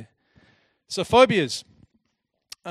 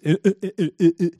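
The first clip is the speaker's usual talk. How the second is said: stuck on a word; 3 semitones higher, 9 dB louder, much faster than usual. Retold or imitated. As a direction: mimic a boy who can't get the word out. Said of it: "gets stuck on the word interest".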